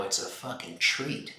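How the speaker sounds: usual speaking voice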